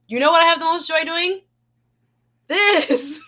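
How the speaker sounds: eager reveal with a laugh; excited. Real, not acted. Host telling viewers what brings her joy, excited.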